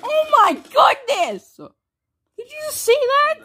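high, drawn-out astonishment; amazed exclaiming. Real, not acted. Gamer checking his friend saw that, amazed exclaiming.

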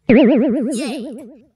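loud high cheer; excited cheer. Acted. Snack-hungry pal cheers at the snack, excited whoop. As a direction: whoop with delight.

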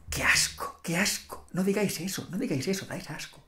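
soft, hushed disgust, partly whispered. Real, not acted. Vlogger reacting to English office jargon, softly disgusted. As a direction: recoil quietly in disgust. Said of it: "disgusted reaction".

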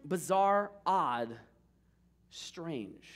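ordinary talk

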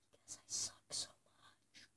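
breathy whispered aside. Real, not acted. Gamer confessing to viewers he's bad, in a whisper.

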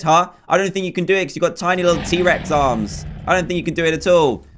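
ordinary talk

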